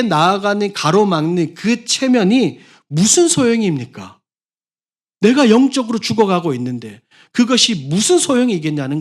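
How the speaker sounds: high, loud urgent preaching; impassioned preacher's challenge; preaching at full force. Acted. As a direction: press the congregation hard. Impassioned sermon passage.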